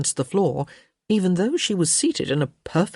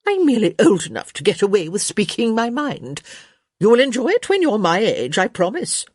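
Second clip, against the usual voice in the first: higher, brisk, assured dowager; imperious Lady Danbury; 6 semitones higher, 4 dB louder than usual. Acted. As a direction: defend outspokenness with relish. Voices Lady Danbury's brisk defence.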